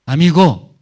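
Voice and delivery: loud, high call to a friend. Acted. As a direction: call out to get a companion's attention. Acting out one leper to another.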